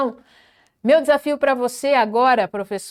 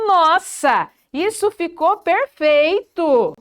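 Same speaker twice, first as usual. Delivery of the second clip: agudo, esticando o elogio; elogio entusiasmado; 5 semitones higher, 3 dB louder, slower than usual. Shown as a demonstration. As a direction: elogie com gosto, bem devagar no fim. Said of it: "Olha que gostoso isso". (admiração animada e enfática)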